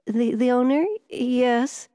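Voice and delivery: hesitant, high-pitched stammer. A narrator's reading. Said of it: "he stammered".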